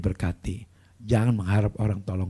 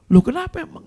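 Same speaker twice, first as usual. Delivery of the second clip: loud, high-pitched retort; acted startled question; 9 dB louder than usual. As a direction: exclaim in exaggerated surprise. Acted reaction to crying person. (pura-pura kaget, nada tinggi)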